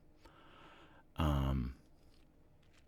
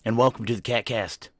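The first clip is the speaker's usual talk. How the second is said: big, loud, higher-pitched show-opener voice; announcer intro; 5 semitones higher, 8 dB louder than usual. Acted. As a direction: announce the podcast with hammed-up fanfare, stretching the last words. Opens the podcast like an announcer.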